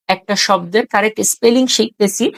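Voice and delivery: ordinary delivery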